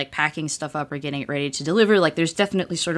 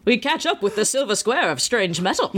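put-on higher, old-fashioned announcer voice; old-timey voice; 5 semitones higher than usual. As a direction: play it like an old newsreel. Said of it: "an old-timey voice now".

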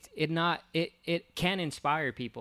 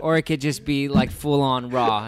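loud; 13 dB louder than usual. Real, not acted. Pitching an unedited episode.

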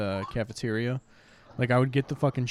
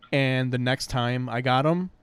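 ordinary talk

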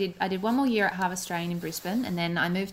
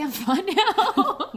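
laughing mock bravery; 13 semitones higher, much slower than usual. Mock brave after losing friend.

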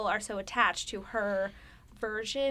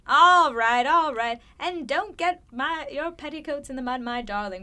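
loud Cockney, arms-length stage Cockney; Cockney accent; 4 semitones higher, 7 dB louder than usual. Retold or imitated. Seventh grader playing Nancy, in a loud Cockney accent. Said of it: "but with, like, the crazy accent".

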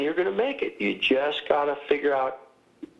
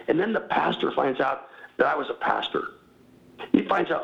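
usual speaking voice

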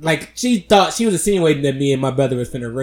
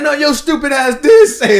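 mock angry retort; 11 semitones higher, 6 dB louder than usual. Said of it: "He's like".

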